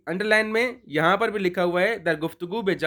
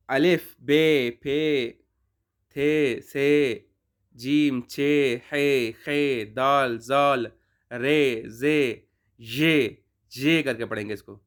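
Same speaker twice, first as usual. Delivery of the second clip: slow, deliberate letter-by-letter recital; name each letter slowly for learners; reciting the alphabet; 3 semitones lower, much slower than usual. Shown as a demonstration. Teacher reciting the Persian alphabet to students. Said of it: "حروف را یہاں پر دیکھیں".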